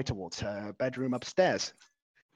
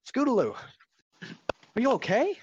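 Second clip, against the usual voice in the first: higher, louder concerned call; worried call; 10 semitones higher, 4 dB louder than usual. Acted. Rainbow Dash calls out worried.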